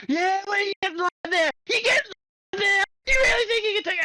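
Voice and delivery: high, loud yelled taunt; imitating a player screaming back after taking the hill; shouting trash talk. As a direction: yell it back at the other team.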